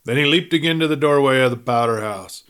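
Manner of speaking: plain reading voice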